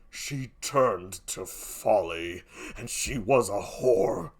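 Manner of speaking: very low, very slow, quiet accusation; grave Othello. Acted. Othello accuses his dead wife, low and grave. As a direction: draw each word out deep and soft.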